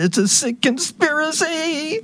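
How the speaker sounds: high mock outrage, put-on voice; mocking exclamation. Host mocking a post about a sick conspiracy, high put-on outrage. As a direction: parrot it back in a silly high voice. Mocks the quoted phrase.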